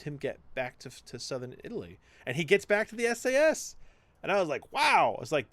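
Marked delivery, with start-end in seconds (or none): 4.7-5.1 much higher and louder wow; reacting to an unbelievable true story; amazed. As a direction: blurt out amazement. His own amazement retold.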